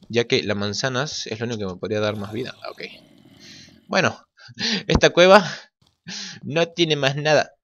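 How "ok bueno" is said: ordinary talk, nothing marked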